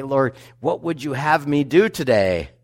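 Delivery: high, loud, eager morning prayer; bright and shiny. Pretend. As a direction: sound bright and ready for the day. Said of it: "all bright and shiny and say".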